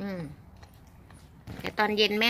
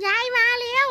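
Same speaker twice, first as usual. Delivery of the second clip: "ยายมาแล้ว" squealed much higher and louder, rising at the end; 12 semitones higher, 6 dB louder than usual. Real, not acted. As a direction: sing out "ยายมาแล้ว" high, like greeting excited puppies. ("ยายมาแล้ว" แหลมสูง ดังขึ้น เสียงเล็กแบบพูดกับลูกหมา)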